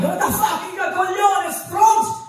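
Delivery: high-pitched Italian outburst; mock meltdown, pitch shooting way up; losing it. Italian re-enacting a couple's fight, comically losing it. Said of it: "lose our shit really bad".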